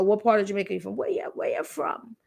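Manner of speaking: imitating a Jamaican accent, the last words faded to an unvoiced hush; mild mock Jamaican accent. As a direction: retell the stranger's question in a light Jamaican accent. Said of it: "with heavy Jamaican accent".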